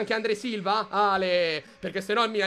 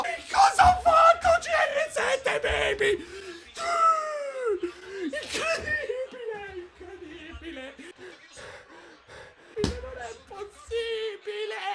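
laughing disbelief; 10 semitones higher, slower than usual. Real, not acted. Disbelief after goal.

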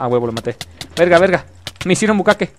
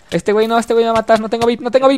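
panicked; 8 semitones higher than usual. Real, not acted. Panicking as an enemy attacks.

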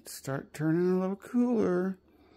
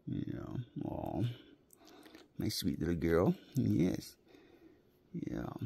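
tender cooing; 7 semitones lower, 5 dB quieter, much slower than usual. Real, not acted. Soft cooing to dog.

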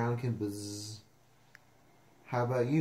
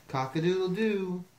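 higher-pitched crowing; rooster crow; 6 semitones higher, 4 dB louder than usual. Acted. Reader reviews the rooster's sound, crowing. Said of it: "He can sound like a rooster".

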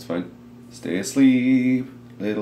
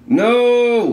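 loud, high cry of despair; anguished shout; 6 dB louder than usual. Real, not acted. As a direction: yell it out in disbelief. Beloved companion Glenn dies.

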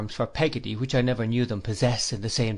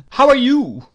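put-on higher, louder brisk old lady's call; brusque aunt; 12 semitones higher, 10 dB louder than usual. A narrator's reading. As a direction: bark a greeting at a timid servant. Aunt addresses quailing Peggotty.

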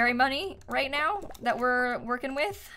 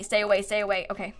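normal talking voice

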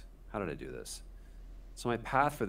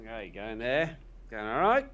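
put-on Australian accent; 5 semitones higher, 4 dB louder than usual. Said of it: "before doing the Australian accent".

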